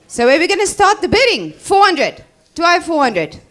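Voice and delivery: auctioneer. Acted. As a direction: call the opening bid out loud. Opens new bidding, louder.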